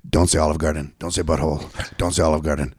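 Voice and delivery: low, quiet, repeated self-warning; inner monologue voice. Retold or imitated. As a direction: mutter it to yourself under your breath. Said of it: "this is my impression of Brian's inner monologue".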